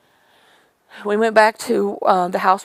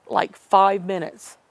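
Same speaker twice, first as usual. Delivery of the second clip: ordinary talk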